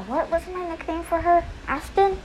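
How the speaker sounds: usual speaking voice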